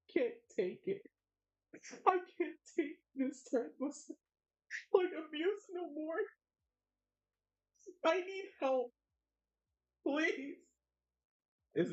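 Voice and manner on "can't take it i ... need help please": high, pitiful mock begging; mock plea. Streamer mock-pleading to chat for help, higher pitched. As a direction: whimper it like a fake victim. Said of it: "you're just like".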